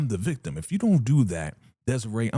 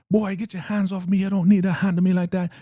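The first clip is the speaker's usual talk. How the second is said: very high, loud, offended voice; indignant woman; 11 semitones higher, 5 dB louder than usual. Retold or imitated. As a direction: shriek at him to let go. El Damara resisting a man.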